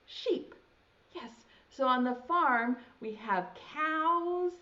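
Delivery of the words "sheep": "Sheep" breathed high with a long sh; whispered sheep. Shown as a demonstration. Teacher prompting kids to say the sign word. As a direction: hiss "Sheep" out like the shears. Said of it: "and say".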